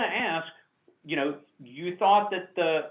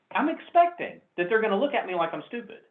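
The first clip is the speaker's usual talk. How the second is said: ordinary talk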